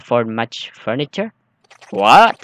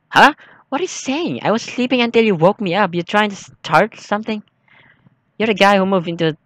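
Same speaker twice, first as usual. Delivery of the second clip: higher put-on voice of an irritated neighbor; annoyed neighbor; 7 semitones higher than usual. Player voicing the angry neighbor woken at night, irritated. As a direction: play the woken neighbor snapping back.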